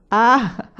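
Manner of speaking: loud anguished sigh-cry. A narrator's reading. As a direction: let out a sigh that breaks into a cry. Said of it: "un sospiro che parve un grido". (sospiro straziante come un grido)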